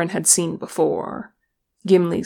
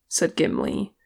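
ordinary delivery, nothing marked

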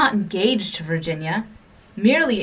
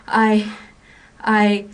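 broken, halting stammer growing louder; 2 semitones higher, 5 dB louder, much slower than usual. A narrator's reading. Young man begging her love, stammering and louder. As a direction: stumble over the words, then push out love me. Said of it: "he stammered".

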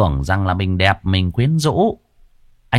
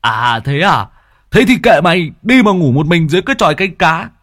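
much higher and louder, fast; huffy outburst; 9 semitones higher, 6 dB louder than usual. Acted. Friend snapping at Dần, high loud huff. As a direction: flounce off in a huff. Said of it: "liền lật lọng".

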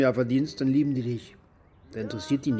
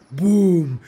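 a loud, punched "boom" for the second blast; sudden loud boom; 5 semitones higher, 9 dB louder than usual. Retold or imitated. Veteran telling how the second bomb hit, loud boom. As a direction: hit the boom hard and let it fall.

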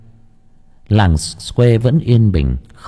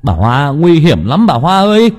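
put-on young man's loud, higher cry of warning; calling out; 7 semitones higher, 4 dB louder than usual. Young villager shouts a warning to Bà Hoa, loud and urgent. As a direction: shout after her in alarm. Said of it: "Một anh thanh niên kêu lên".

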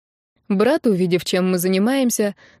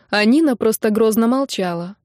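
ordinary delivery, nothing marked

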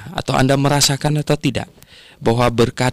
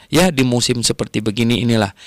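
ordinary talk, nothing marked

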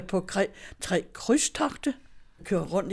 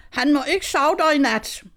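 imitating her son calling out, higher and louder; mock yell of an objection; retold shout; 5 semitones higher, 5 dB louder than usual. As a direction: call it out as a retold protest. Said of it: "Han råber".

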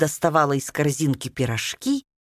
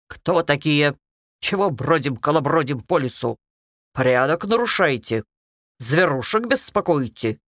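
bass voice; 2 semitones lower than usual. Acted. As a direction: bark it in a heavy bass. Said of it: "Басом произнесла девочка".

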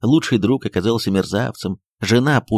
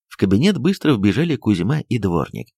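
ordinary delivery, nothing marked

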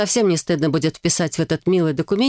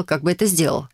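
ordinary delivery, nothing marked

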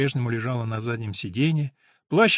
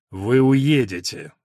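impatient; 5 dB louder, much slower than usual. Acted. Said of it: "прорвалось нетерпение".

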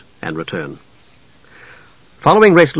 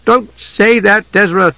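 higher, slow urgent outcry; sudden cry; 8 semitones higher, slower than usual. A narrator's reading. Tika crying out to stop Desra's words. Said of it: "Tika cried suddenly".

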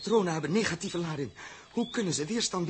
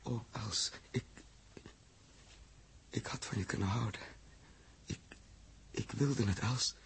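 soft, low broken confession; grieving murmur; 5 semitones lower, 13 dB quieter than usual. Acted. Captain mourning Els, soft and broken. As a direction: let the grief crack through quietly. Grief over dead girl.

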